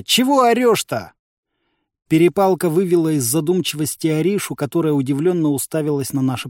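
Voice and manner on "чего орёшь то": high, challenging call; raised voice. A narrator's reading. Fighter asks enemy why he's yelling, raised voice. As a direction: yell back across the gap.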